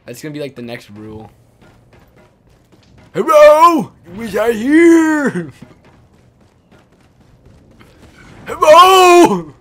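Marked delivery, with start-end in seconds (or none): 3.1-9.5 loud high hollering hello; calling out. Pretend. Player calling teammates across the map, loud and high. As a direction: yell to be found, drawn out.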